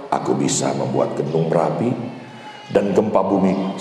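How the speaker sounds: suara Tuhan yang dalam dan berat; divine voice. Acted. God's voice in sermon story. (suara Allah yang rendah dan berwibawa)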